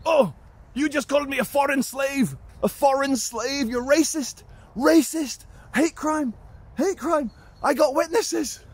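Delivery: very high, hammed-up accusing voice; mock a scandalised Eastern European guard; shrieky put-on accent, piling up accusations; outraged Eastern European guard. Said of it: "he instantly goes".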